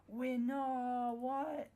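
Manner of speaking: high, loud startled cry; shocked outburst. Real, not acted. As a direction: caught off guard, disbelieving. Shocked by game event.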